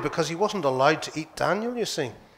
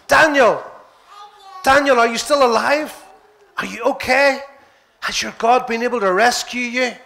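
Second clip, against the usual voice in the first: calling out; 6 semitones higher than usual. Acted. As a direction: call anxiously to someone far below. King calling out at the den.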